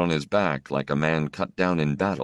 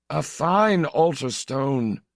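put-on high, slow old priest's voice; old priest agreeing; 11 semitones higher, slower than usual. Old priest to the stranger, agreeing, high and slow. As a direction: gently approve like a kindly old priest. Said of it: "Father Agius agreed".

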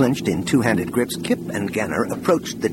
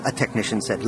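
plain reading voice

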